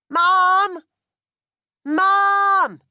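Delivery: very loud, high Cockney bellow; boy bellowing down the passage for his mother. Acted. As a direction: call for mum down the passage at full lung. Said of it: "he bellowed".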